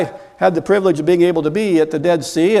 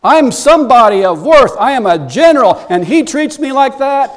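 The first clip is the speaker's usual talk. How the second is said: acting an offended general, louder and higher; indignant anger; 4 semitones higher, 4 dB louder than usual. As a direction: rage like a snubbed man of rank. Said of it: "he is angry".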